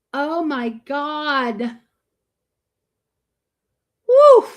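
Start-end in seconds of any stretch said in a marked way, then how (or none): 4.1-4.5 loud, high woo; big high-pitched woo; cheering whoop. Real, not acted. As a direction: whoop in excitement. Cheering shout.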